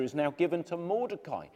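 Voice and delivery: ordinary delivery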